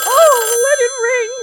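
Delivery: high, loud frantic cry; desperate outburst. Acted. Frightened wife shouting at the ringing phone. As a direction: burst out in panic.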